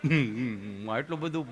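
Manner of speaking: low, hesitant objector; hum, then object in a low voice; Gabho. Acted. Patient objecting to the nurse's sweetness, low and halting. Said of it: "અહીંયા ગભો બેઠો હતો".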